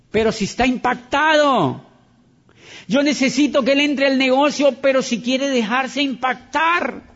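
loud, high preaching to the crowd; fired-up preacher hammering the point; full-force preaching. Acted. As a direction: drive it home like a revival preacher.

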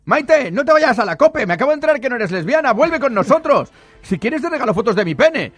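high, loud begging call; pleading. Acted. Host loudly begging Maite to come back.

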